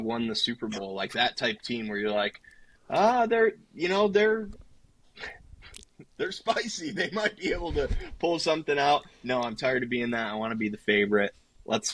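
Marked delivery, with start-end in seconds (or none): 2.9-9.0 high-pitched, teasing imitation of a hopeful fan; mock underdog hype. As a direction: play a fan tentatively talking up an underdog, higher pitch. Voices fans talking up underdog.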